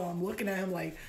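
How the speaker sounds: usual speaking voice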